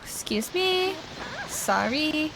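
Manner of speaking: high, softened singsong apology; playful polite pardon. Pretend. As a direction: squeeze past with light, high politeness. Pushing through a crowd in game.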